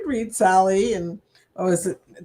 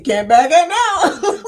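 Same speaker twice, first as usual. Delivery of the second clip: imagined husband thought; 8 semitones higher, 7 dB louder, slower than usual. Retold or imitated. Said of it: "he's probably thinking".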